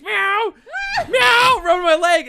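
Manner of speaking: high, loud meowing like a kid playing cat. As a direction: shout the meows high like a cat.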